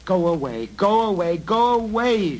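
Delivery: frantic cries of go away. A narrator's reading. Girl shutting out the goblins, rising frantic cries. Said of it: "she whispered under her breath".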